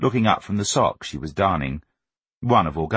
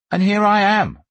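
put-on high, loud boyish declaration; self-righteous boy; 13 semitones higher, 5 dB louder than usual. A narrator's reading. Augustus thinking himself the common man, self-righteous. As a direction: proclaim it like a wronged little orator. Boy's indignant inner speech.

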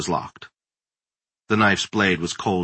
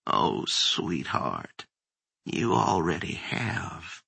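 very low, slow, softer croon; sinister old crone; 4 semitones lower, 8 dB quieter, much slower than usual. A narrator's reading. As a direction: taunt her like a gloating witch. Monster taunting after raspy chuckle.

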